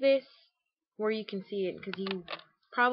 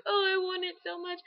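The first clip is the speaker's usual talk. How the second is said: high, excited pleading; eager longing; 11 semitones higher, 4 dB louder than usual. Retold or imitated. To the French salesman, a high excited gush. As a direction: sell how badly you want it, high and bright. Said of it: "and I was like".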